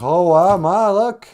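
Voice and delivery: loud, high amazed calling out; excited boy. Acted. Jack shows Ma the giant beanstalk, loud excitement. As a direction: crow to mom that you were right, loudly.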